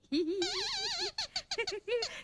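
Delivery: long, high giggle; giggling. Acted. Laughing at his own duck impression, high giggle. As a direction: giggle away at the silly joke.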